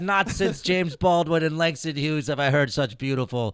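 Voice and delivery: mock-solemn praise of the chant's poetry; mock reverence. Real, not acted. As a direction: gush sarcastically like a literary critic.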